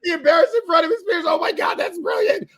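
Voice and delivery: high-pitched, cracking up; real amazed laughter. Real, not acted. Listener finally gets the Oscars joke, laughing in amazement. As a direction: squeal through the laughter in delight.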